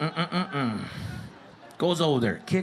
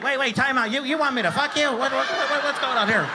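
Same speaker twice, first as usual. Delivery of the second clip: higher, loud baffled ref; confused referee; 8 semitones higher, 3 dB louder than usual. Retold or imitated. The referee asking what is going on, higher and baffled. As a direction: stop the game in disbelief. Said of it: "Even the ref was like".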